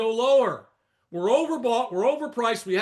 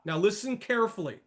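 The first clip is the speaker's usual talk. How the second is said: ordinary talk, nothing marked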